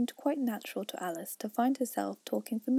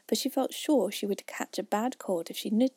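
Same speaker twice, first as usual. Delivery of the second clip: ordinary delivery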